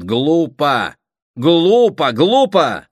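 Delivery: indignant, rising repetition; angry outburst. Acted. Brother scolding the family's plan, angry outburst.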